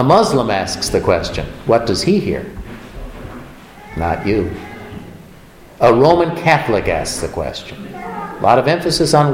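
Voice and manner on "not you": low, quiet flat judgment; mirror's verdict. Acted. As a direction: deliver the verdict low and soft.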